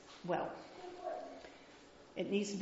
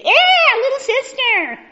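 loud, high mock whoop; mock cheer; 18 dB louder than usual. Teacher offering the wrong answer to her class, cheering loudly. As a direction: shout it like a cheering boy.

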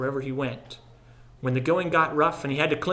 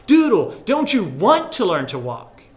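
much higher and louder, fed up; exasperated call; 5 semitones higher, 9 dB louder than usual. A narrator's reading. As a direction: shout at the reluctant boy. Frustrated brother calling Doodle.

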